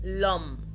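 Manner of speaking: لوم said with no H sound at all; French لوم, H left unsounded; silent H. Shown as a demonstration. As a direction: run the article straight into the vowel in لوم. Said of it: "ما نطقتش القش خالص".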